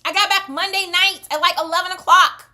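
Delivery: high, loud emphatic exclaiming; exasperated. Real, not acted. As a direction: shout it in disbelief at the timing. Exasperated at bad luck so soon.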